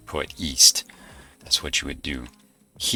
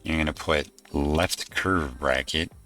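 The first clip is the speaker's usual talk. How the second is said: dictating. Shown as a demonstration. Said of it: "and this is the code".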